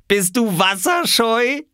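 high, drawn-out taunt; teasing call. A narrator's reading. Small boy mocking a hesitant swimmer, high teasing call. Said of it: "rief Oskar und lachte".

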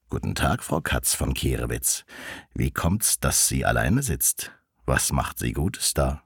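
low-pitched courting wolf; deep wolf voice paying a call; wolf suitor. A narrator's reading. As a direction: greet as the wolf, pitched well down.

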